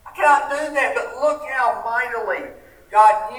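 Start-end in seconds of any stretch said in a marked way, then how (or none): 0.0-1.0 higher, louder, hurried protest of unworthiness; Moses pleading. Retold or imitated. Moses begging God to send someone else, higher and louder. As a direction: plead like a man who feels unable. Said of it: "Moses says".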